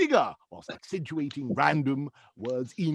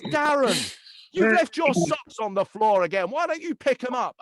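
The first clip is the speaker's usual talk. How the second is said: wife's nagging; 6 semitones higher than usual. Retold or imitated. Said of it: "And the wife says".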